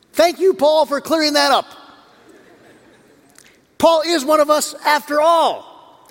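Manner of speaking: a loud, high, hammed-up cheer from the crowd; mock crowd shout. Preacher playing the crowd with a hammed-up happy shout. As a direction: shout it like a grateful mob. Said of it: "what do you think they shouted".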